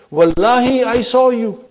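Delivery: Wallahi hit high and loud, then I saw you; loud, insistent oath; emphatic oath. Shown as a demonstration. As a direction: swear it loudly, pressing Wallahi. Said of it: "what does it mean".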